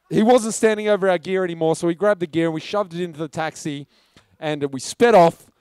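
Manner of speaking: usual speaking voice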